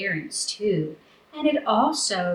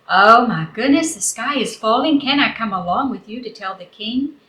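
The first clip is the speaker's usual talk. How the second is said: loud, raised oh my goodness; startled gasp; 3 semitones higher, 6 dB louder than usual. Acted. Turkey Lurkey hearing the news, loud alarmed surprise. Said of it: "She said".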